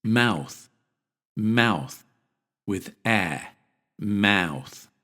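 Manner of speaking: mouth, careful American ow; pronunciation demo. Shown as a demonstration. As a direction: mouth with ow as in cow. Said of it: "most American speakers pronounce it like this".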